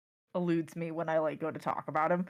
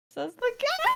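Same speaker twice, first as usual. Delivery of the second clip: high squeaky voice; 6 dB louder than usual. Acted. Narrating cat's line.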